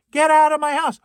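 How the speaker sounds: a half-comic outraged yell; indignant shout. Acted. Character yelling at invaders.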